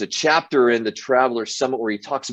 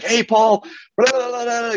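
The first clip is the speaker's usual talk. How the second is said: much higher, gushing excitement; excited fan; 14 semitones higher than usual. Retold or imitated. Race worker gushing at an eighty-year-old runner, high excited squeal. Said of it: "got, got all excited".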